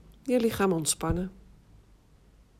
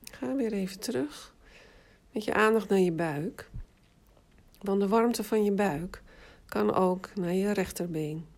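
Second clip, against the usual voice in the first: guided meditation. Acted. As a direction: guide them into rest, unhurried. Leads autogenic relaxation exercise. (rustige meditatiestem)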